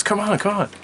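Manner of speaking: high, loud, insistent sister; eager kid's call to follow; excited urging. Retold or imitated. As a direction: urge a sibling along, high and loud. Sister urges him out of bed.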